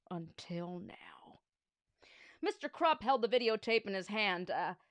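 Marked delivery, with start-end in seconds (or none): 0.1-1.4 menacing whisper. Acted. Principal reveals his proof, whispered menace. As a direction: hiss the last words like a threat.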